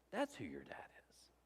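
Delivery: soft, breathy sermon whisper. Real, not acted. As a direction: lean in and whisper it.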